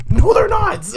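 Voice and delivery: loud, very high mock yell; imitated indignant shout; exasperated movie quote. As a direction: burst out in comic disbelief. Quoting the film's exasperated outburst.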